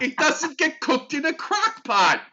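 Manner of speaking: high, loud, laughing protest; laughing outrage. Real, not acted. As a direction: cry out, laughing in disbelief. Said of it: "I knew you'd have that reaction".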